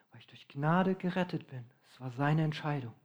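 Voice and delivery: hushed whisper of assurance. Real, not acted. Preacher whispers the answer to the congregation. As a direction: whisper it like a secret truth. Sermon climax on grace.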